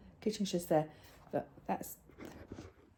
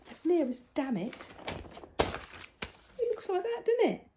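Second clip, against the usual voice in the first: much higher, thrilled showing off; excited; 9 semitones higher than usual. Real, not acted. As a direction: beam over your own handiwork.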